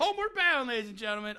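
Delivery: Homeward Bound shouted out high like a showman; grand announcement. Pretend. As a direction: present it like a game show host. Announcing friend's movie pick.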